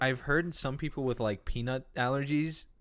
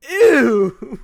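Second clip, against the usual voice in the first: loud, high ew; disgusted; 11 semitones higher, 18 dB louder than usual. Real, not acted. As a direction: recoil with a big, high ew. Disgusted by picture of cheese.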